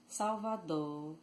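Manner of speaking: "Salvador" slowly, fading into a long final o; dropped final R. Shown as a demonstration. Teacher repeating the Bahian way, slow "Salvador" without final R. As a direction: stretch the last vowel of "Salvador" without any R. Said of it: "leave the R out a little bit".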